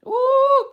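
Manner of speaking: high laugh then squeal; loud laugh. Real, not acted. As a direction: crack up laughing. Laughing after the punchline.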